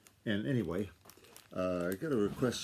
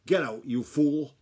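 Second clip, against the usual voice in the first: sharp, louder and higher woman's shout; sharp yell; 5 semitones higher, 8 dB louder, slower than usual. A narrator's reading. Woman in the barn yelling at a fool, sharp shout. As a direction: yell it slow and clear, raised pitch. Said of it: "a woman's voice came sharp and clear".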